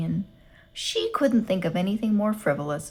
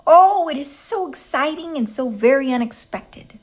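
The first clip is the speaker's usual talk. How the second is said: excited; 6 semitones higher, 7 dB louder than usual. A narrator's reading. Baby claps her hands, thrilled.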